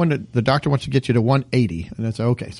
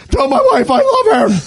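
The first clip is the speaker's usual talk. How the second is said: melodramatic; 10 semitones higher, 5 dB louder than usual. Pretend. Dramatic dying farewell joke.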